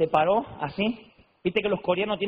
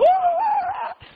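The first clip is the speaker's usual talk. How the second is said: high, loud joyful whoop with a laugh; a shrill church cry of joy, imitated; mock joyful whoop; 5 dB louder than usual. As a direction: whoop like a worshipper caught up in praise.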